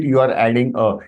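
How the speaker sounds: ordinary delivery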